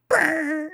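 loud high "brrr" whir; sudden high-pitched motor-like brrr; imitated rushing noise. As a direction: make a high, loud buzzing zoom sound. Noise for the rushed last episodes.

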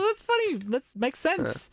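very high, slow and drawn-out amused remark; retelling his own reaction to the droid joke; mock reaction. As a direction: squeak it out slowly, half-joking. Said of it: "I was like".

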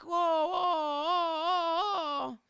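high, slow wailing whoa; mock frightened granny; startled old lady. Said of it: "She's like".

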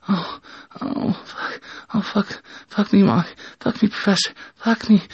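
high, moaning character voice; words moaned in a high put-on voice; pleading high-pitched moan; acted moaning.

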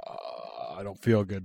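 imitating a sick, sinking voice; low, soft and groggy; mock queasy groan. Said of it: "And he's just like".

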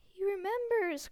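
high, loud delighted cry; excited cry. A narrator's reading. Diggle crying out to the crowd in delight. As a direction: burst out with thrilled joy. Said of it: "cried Daedalus Diggle".

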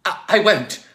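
stammered, high, loud refusal; commanding. Acted. Logue refuses tiger Joe, stammering command. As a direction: refuse loudly after a faltering start. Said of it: "Logue commanded".

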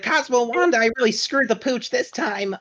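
high, bright cartoon boy voice; Timmy Turner. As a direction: play the panicked cartoon kid. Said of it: "And he's like".

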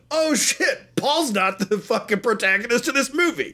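high, laughing mock shock; mock shocked viewer. Podcaster playing a stunned viewer, high and laughing.

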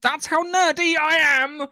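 loud, high, drawn-out nerdy boast; triumphant high holler with laughter in it; gleeful shout. Real, not acted. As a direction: shout it slowly and proudly, close to laughing. Proudly showing off fan knowledge.

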